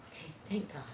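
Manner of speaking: whispered, private relief; relieved. Retold or imitated. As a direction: whisper it as if let off the hook. Relieved aside on hearing the news.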